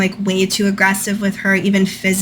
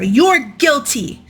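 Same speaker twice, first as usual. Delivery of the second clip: mock angry yell, higher and slow; retold yell; 6 semitones higher, slower than usual. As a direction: slowly hurl the accusation, pitched up. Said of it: "Jim Bob yells at her".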